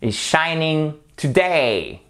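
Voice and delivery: "is shining today" très lent, SHI et DAY étirés; accents toniques marqués. Shown as a demonstration. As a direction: appuie sur "shining", puis laisse tomber "today". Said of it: "on a un accent tonique ici". ("is shining today" exagérément articulé, fin descendante)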